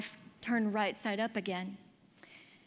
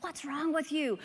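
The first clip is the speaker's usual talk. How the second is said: mom scolding; 5 semitones higher than usual. Retold or imitated. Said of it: "she's like".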